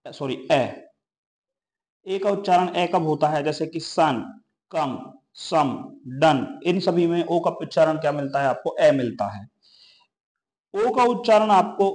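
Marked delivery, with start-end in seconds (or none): 3.8-6.6 कम and सम stretched slowly; slow classroom reading of कम, सम; slow example words. Shown as a demonstration. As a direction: read कम, सम slowly, one at a time. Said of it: "reading example words".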